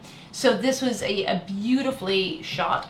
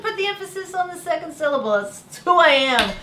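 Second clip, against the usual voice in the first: higher, louder, giggly confession; amused mock exasperation, high and loud; laughing self-mockery; 7 semitones higher, 5 dB louder, faster than usual. Real, not acted. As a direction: laugh at your own slip, pitch climbing. Laughing self-mockery at American slip.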